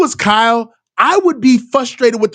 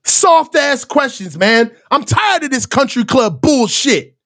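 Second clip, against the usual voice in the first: ordinary talk, nothing marked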